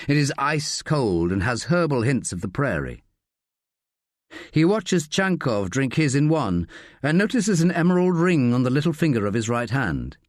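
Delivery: ordinary delivery, nothing marked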